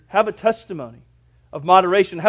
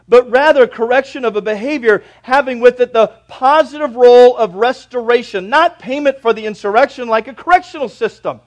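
loud, raised pulpit delivery; full-force preaching; 6 semitones higher, 11 dB louder than usual. Real, not acted.

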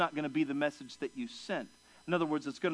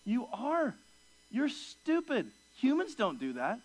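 much higher, slow mock scolding of the dog; 7 semitones higher, slower than usual. Owner scolding his dog for eating vomit, in mock exasperation. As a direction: tell the dog off, half-joking.

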